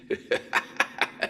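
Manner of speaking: a breath of a chuckle under the voice; a soft laugh alone in a dark house. Acted. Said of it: "That was me laughing".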